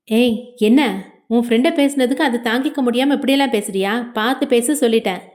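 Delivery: ordinary delivery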